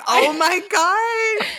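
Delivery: hammed-up shock, stretched slow; mock horrified reaction. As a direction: imitate someone appalled, stretching each word. Imagines the shocked facialist's reaction.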